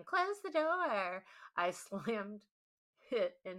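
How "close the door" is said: mock shriek. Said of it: "shrieked in surprise, shouting".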